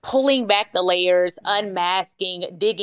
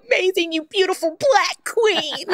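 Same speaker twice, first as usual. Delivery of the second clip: much higher, gushing, tearful-sounding delight; gushing thanks; 12 semitones higher than usual. Retold or imitated. Host reenacting thanking her therapist, gushing high. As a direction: gush like you're overwhelmed with gratitude. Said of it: "I was like".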